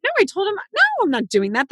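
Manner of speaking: put-on babysitter's high, emphatic no; retold firm refusal. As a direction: imitate refusing a child outright, starting high. Retells babysitter refusing the child.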